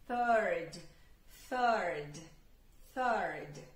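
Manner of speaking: "third" with a clear th and a final "erd"; th sound drill. Shown as a demonstration. Teacher drills the th for learners. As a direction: repeat "third" stressing the th and the rd. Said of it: "coloca o erd".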